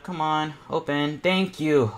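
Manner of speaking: usual speaking voice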